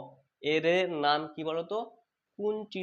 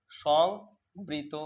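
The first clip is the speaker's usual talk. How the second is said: slow teacher model; drawn-out drill; much slower than usual. Shown as a demonstration. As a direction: hold সংবৃত very slowly, syllable by syllable. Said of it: "কি রয়েছে বলো তো?".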